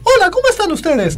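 very high put-on falsetto greeting; high squeaky voice. Shown as a demonstration. Imitator demonstrating an easy voice anyone can copy, high-pitched voice. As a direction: leap way up into a cartoonish high hello. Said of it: "O si te digo que hagas".

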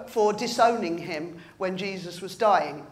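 ordinary delivery

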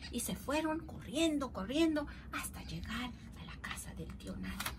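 plain reading voice

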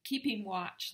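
usual speaking voice

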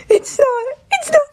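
high breaking sob on the words; crying. Acted. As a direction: cry the line out. Acting a crying line.

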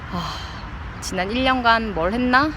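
ordinary talk

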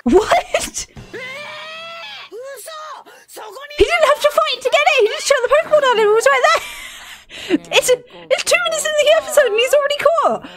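high, loud disbelief; high, loud shocked ranting; shocked outburst. Real, not acted. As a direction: yell in stunned surprise. Shocked at the quick catch.

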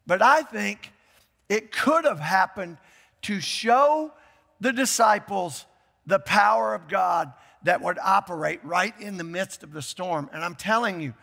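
usual speaking voice

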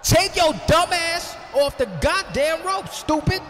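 yelling at wrestler. Real, not acted. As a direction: bellow it down at the ring like he can hear you. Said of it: "Can't hear me from the booth".

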